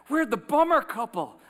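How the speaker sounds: beaming couple introducing themselves; excited. Retold or imitated. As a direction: proudly announce yourselves. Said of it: "this couple came up to me like".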